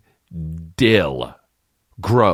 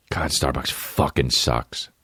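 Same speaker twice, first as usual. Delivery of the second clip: usual speaking voice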